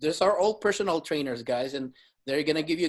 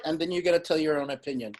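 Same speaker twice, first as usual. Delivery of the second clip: ordinary delivery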